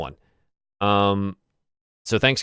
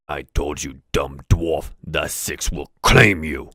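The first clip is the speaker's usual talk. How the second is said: low gloating orc; a low, taunting orc mocking the dwarf; 6 semitones lower, slower than usual. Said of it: "the orc says".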